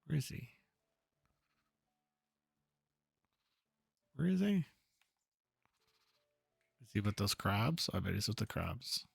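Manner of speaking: searching hero voice. Acted. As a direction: look around for him, curious and a bit worried. Voices searching game character.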